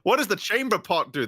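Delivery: high, curious question; childlike voice. Retold or imitated. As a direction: ask like an eager child. Voicing Pinocchio.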